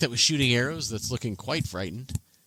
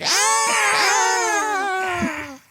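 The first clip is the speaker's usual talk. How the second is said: very high, drawn-out "Ah!" wail; panicked goblin scream; 3 dB louder than usual. Acted. Game master voices goblins running for their lives, panicked scream. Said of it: "run for their lives going".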